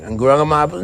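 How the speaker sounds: ordinary talk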